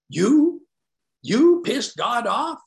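loud, high incredulous mockery; mock him with a rising, drawn-out question; mocking disbelief. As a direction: hammer YOU, high and incredulous. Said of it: "I knew he was mocking me".

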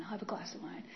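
soft, secret whisper giving in; hushed whisper, giving in to the craving; whispered surrender. Retold or imitated. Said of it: "This is every day now".

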